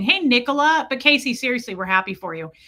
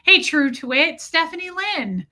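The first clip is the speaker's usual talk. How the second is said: ordinary talk, nothing marked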